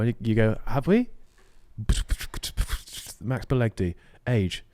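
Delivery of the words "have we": surprised. Retold or imitated. Acts surprised reply.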